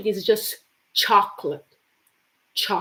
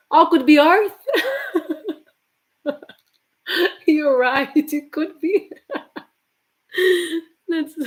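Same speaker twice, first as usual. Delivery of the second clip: laughing with delight at a clever answer; 6 semitones higher, 4 dB louder than usual. Real, not acted. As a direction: chuckle through it, pleasantly surprised.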